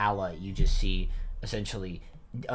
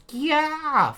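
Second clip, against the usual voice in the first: very high, loud panicked shriek; freaking out; 11 dB louder than usual. Acted. Bonnie spots something scary, freaking out. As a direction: shriek it in sudden alarm. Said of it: "freaking out".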